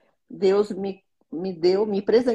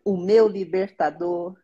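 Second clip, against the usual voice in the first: slow, devout reading of a psalm; prayerful psalm reading; slower than usual. Acted. Mother reading her daily psalm to viewers, slow and devout. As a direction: read each verse slowly like a prayer.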